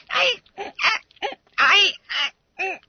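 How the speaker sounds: very high squeaky stammering; frozen with fright; terrified stammer. Acted. Caught boy to his master, high terrified stammer.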